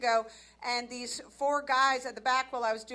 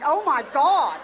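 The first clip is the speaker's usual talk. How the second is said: shocked disbelief; 5 semitones higher, 4 dB louder than usual. Retold or imitated. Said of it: "I'm like".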